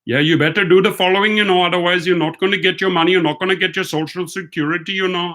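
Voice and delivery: imitating an Indian accent, fast and high; mock Indian call-center scammer voice, rushed. Scammer on the phone to him, in a mock Indian accent. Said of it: "in an Indian accent".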